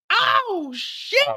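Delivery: shocked cry. Acted. Kid shoots himself, shocked.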